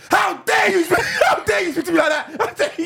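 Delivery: high, loud furious scold; screaming. Retold or imitated. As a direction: scream at him, half laughing. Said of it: "I scream".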